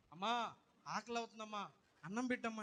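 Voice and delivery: put-on a little boy asking his mother for rice; child's voice.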